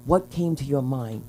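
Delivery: imitating her husband. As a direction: quietly voice the husband.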